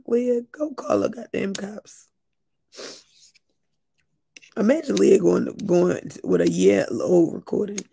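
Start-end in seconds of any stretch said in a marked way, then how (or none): none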